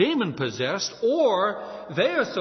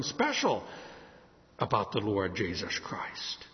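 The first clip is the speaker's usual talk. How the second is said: whisper; 9 semitones lower, 8 dB quieter, slower than usual. Real, not acted. Preacher hushes for reverent emphasis.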